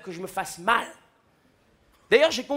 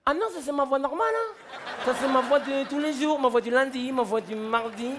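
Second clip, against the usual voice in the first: higher, breezy dad voice; dad brushing off the cop, pitched up; carefree drunk dad; 5 semitones higher than usual. Retold or imitated. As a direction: toss it off like it's nothing. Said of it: "Mon père, il a dit".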